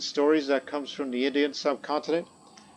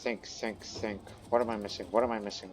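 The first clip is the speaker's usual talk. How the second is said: muttering to himself; 3 semitones lower, 9 dB quieter than usual. Real, not acted. Muttering to himself while deciding.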